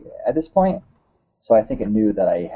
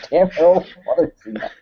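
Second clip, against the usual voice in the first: fake Russian accent; 7 semitones higher, 3 dB louder, faster than usual. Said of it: "fake Russian accent".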